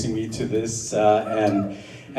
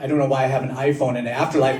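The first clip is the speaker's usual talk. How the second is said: ordinary delivery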